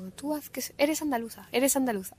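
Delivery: lightly voiced coach insisting; imitating the coach. Actress retelling her accent coach's pep talk, mock urging. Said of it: "la coach justo siempre me decía como".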